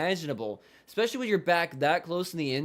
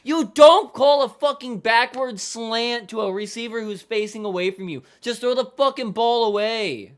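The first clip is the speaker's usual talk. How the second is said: higher, louder furious yelling at the play; yelling at the TV over a dumb play call; angry yelling; 4 semitones higher, 6 dB louder than usual. Real, not acted. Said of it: "make me lose my fucking voice".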